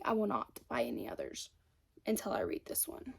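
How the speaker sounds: hushed whispered repeat of her vow. Shown as a demonstration. Whispering a book-buying vow to herself. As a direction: whisper it like a secret promise to yourself.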